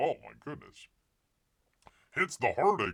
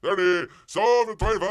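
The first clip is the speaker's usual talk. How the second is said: rapid-fire auction chant, building louder and higher; mock auctioneer; 6 semitones higher, 10 dB louder than usual. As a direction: rattle off bids like a hammed-up auctioneer. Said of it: "I wonder how that goes".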